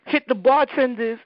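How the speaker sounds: usual speaking voice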